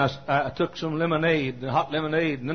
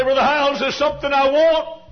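much higher and louder, calling out a want; eager congregant; 12 semitones higher, 8 dB louder than usual. Retold or imitated. Congregant to the preacher, eagerly calling out a request. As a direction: play an eager churchgoer calling out.

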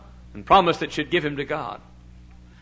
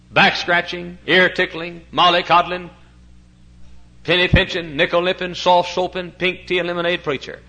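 fiery preaching; 3 semitones higher, 4 dB louder than usual. Acted. Pastor preaching to his church about preachers, full-force fiery preaching. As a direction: hammer each word home to the congregation.

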